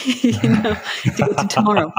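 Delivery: chuckling through the words; laughing. Real, not acted. Host laughing at her own time-zone joke to guest. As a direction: laugh it out lightly.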